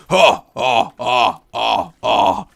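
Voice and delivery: slow, low, menacing villain; deliberate, deep sinister drawl; deep threatening voice. Shown as a demonstration. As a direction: loom over them in a deep villain voice. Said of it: "a really deep, threatening voice".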